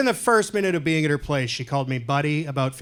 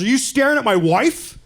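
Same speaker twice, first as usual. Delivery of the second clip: sharp, high, louder accusing question; angry husband; 8 semitones higher, 4 dB louder than usual. Retold or imitated. Jealous husband challenging a stranger, high and louder. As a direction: confront him about staring at your wife.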